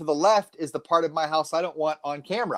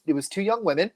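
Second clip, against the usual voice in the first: usual speaking voice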